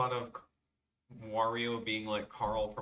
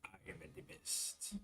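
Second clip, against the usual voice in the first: breathy whispered boast; whispered brag; 5 semitones lower, 14 dB quieter than usual. Acted. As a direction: hiss the boast quietly.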